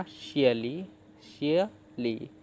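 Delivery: "शियली" slowly split as शिय, pause, ली; broken into syllables. Shown as a demonstration. Teacher showing what the parts make, "शियली" split slowly in two. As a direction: sound out "शियली" in two separated chunks. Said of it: "तो क्या बना".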